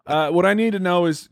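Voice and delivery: ordinary talk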